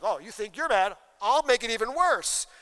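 mock wife raising the stakes; a half-hammed furious wife. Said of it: "she's like".

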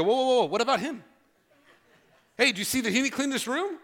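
put-on a boy deflecting blame onto his brother; whiny kid protest. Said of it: "They go".

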